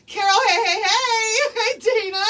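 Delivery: high, loud gushing hellos; excited greeting. Real, not acted.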